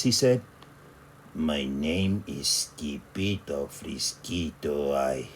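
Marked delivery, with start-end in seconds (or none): 1.3-5.3 much softer, lower and slow. Acted. Skipito to the bandit in a very soft voice. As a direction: barely voice it, timid. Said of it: "in a muy, muy soft voice".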